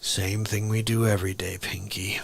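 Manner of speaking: deep deadpan, then stretch the last phrase slowly; the Brain. Acted. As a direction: Orson Welles-style mastermind. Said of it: "did a impression of Orson Welles".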